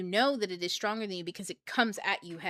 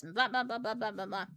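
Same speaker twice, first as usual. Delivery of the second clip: ordinary delivery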